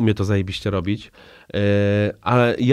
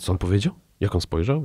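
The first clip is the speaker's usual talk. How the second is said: soft, lower mock-suspicious questions; wary questioning; 3 semitones lower, 6 dB quieter than usual. Imitating touchy listeners reacting to a remark, wary and lowered. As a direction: voice onlookers' hushed suspicion, lightly acted.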